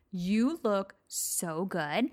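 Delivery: slow, stretched-out admiring line; lightly imitated coworker stretching out the praise; drawn-out compliment. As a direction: drag each word out, mock-gushing. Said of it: "And she's like".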